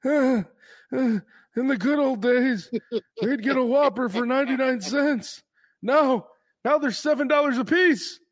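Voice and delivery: put-on old-timer voice. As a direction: reminisce like a grandpa. Mock old-timer reminiscing.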